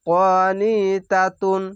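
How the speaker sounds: slow, drawn-out chanted recitation; Quranic recitation. Shown as a demonstration. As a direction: recite each word slowly with tajweed. Recited demo word.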